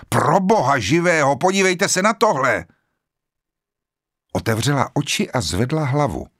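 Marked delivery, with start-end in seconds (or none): 0.1-2.6 vyděšený výkřik, vysoko a hlasitěji; vykřikni to zděšeně, pozor na tohle. A narrator's reading. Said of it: "uslyšela náhlý výkřik". (zděšený výkřik muže se svíčkou)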